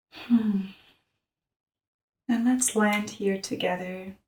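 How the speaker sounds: soft, hushed meditation voice; softly led breathing practice; guided meditation. Real, not acted. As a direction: guide them gently into the breath, with long pauses.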